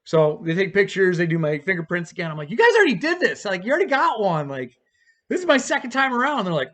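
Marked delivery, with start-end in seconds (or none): 2.5-6.5 high-pitched indignant complaint; re-enacted objection, voice jumping up; exasperated protest. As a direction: protest a pointless repeat in disbelief. Acted protest at repeated fingerprinting.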